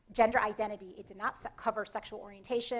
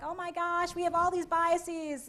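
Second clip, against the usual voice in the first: higher, slow mock despair; mock dismay; 6 semitones higher, slower than usual. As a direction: sigh it out like someone overwhelmed by guilt. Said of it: "feeling beaten down like".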